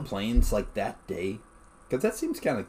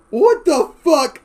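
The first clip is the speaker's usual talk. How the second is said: high, loud, slow what the fuck; stunned drawn-out outburst; incredulous exclamation; 14 semitones higher, 11 dB louder, much slower than usual. Retold or imitated. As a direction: stretch it out in disbelief. Reacting to uncle's story.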